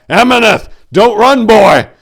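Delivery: loud desperate shout; shouted after his runaway brother; crying out. A narrator's reading. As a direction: call after the fleeing boy at full voice. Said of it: "Tor cried out".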